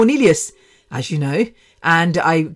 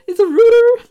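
very high and loud, "router" said as ROO-ter; mock British insistence; 7 dB louder than usual. Podcaster insisting on the British "router" in a shrill squeal. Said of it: "it does my head in when Americans say".

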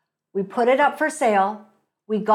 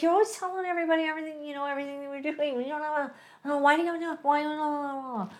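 higher, hushed, rapid nagging mumble; mimicking husband's voice; 5 semitones higher, 8 dB quieter, much faster than usual. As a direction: imitate your husband's grumbling, softly trailing into babble. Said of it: "when I do his voice like that".

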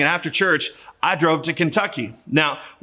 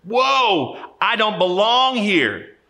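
higher, slower mock alarm; Isaiah overwhelmed before God, higher, slow mock fright; frightened retreat; 5 semitones higher, much slower than usual. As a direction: back away in awe and fear. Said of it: "He says".

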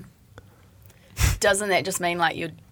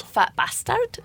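put-on Scottish accent; slower than usual. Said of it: "Just, just do a Shrek accent".

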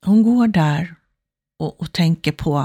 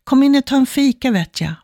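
acted neighbour's call, high and a bit louder; friendly calling out; 6 semitones higher, 3 dB louder than usual. Favourite neighbour calling her in for coffee, calling out. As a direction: call the invitation out high, dropping at the end. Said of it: "öppnar dörren och ropar".

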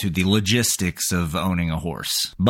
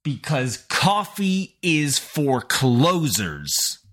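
higher, very slow, deliberate declaration; grand proclamation; 6 semitones higher, much slower than usual. Pretend. Host saluting a caller who closed fast, slow grand proclamation. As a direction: proclaim it word by word, drawn out.

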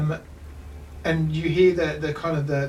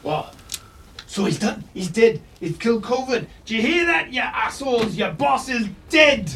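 gloating dwarf shout; 7 semitones higher than usual. Acted. Dwarf taunts the enemy guards, high and shouting. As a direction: crow it at the enemy like a battle-mad dwarf.

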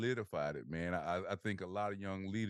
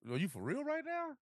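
much higher, squeaky disbelieving question; incredulous. Retold or imitated. Soldier retelling being told he's moved, incredulous high squeak. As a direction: throw your voice way up in shock. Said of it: "And I'm like".

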